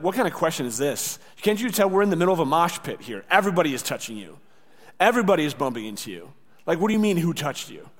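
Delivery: fast, incredulous protest; exasperated disciples. Acted. Disciples questioning Jesus in a packed crowd, exasperated. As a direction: rattle it off like it's obvious.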